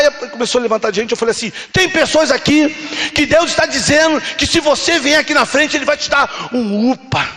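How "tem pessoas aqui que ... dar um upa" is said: impassioned altar call. Retold or imitated. Preacher calling people to the front, impassioned altar call. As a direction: call them forward as a preacher, stretching key words. Re-enacts call to come forward.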